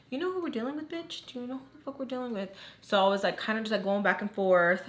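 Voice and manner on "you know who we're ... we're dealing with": soft, high-pitched mock challenge. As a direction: challenge them under your breath, pitched up. Acted challenge in a retold thought.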